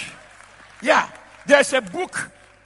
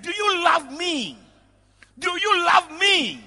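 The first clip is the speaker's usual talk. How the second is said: pleading shout; 6 semitones higher, slower than usual. Retold or imitated. Preacher acting Jesus asking Peter, pleading slow shout. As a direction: cry out the question, drawn out. Pleading question in retold story.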